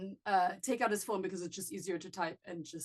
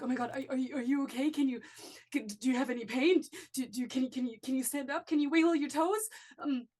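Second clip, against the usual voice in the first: higher, rushed, questions tumbling out; frantic worry; 4 semitones higher, 5 dB louder, faster than usual. Acted. Brother checking his sister for injuries, frantic. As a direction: fuss over her in a panic.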